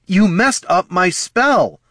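stunned outburst, drawn out; shocked. A narrator's reading. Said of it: "more shocked than angry".

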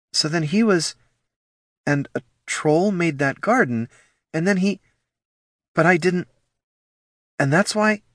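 high, halting fragments; stunned, broken-off realization; dawning realization. Acted.

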